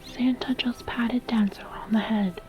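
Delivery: plain reading voice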